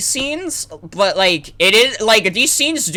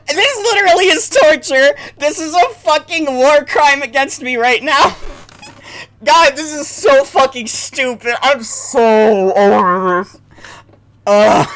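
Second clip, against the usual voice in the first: higher, loud fed-up groaning; exasperated; 8 semitones higher, 4 dB louder than usual. Real, not acted. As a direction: complain like it's torture. Exasperated with game.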